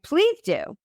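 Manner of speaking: high, eager plea; delighted acceptance. Retold or imitated. Delightedly accepts a nickname in story.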